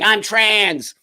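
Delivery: mock a pushy activist shouting slogans, laughing through it; mocking loud activist. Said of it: "I don't walk into a room going".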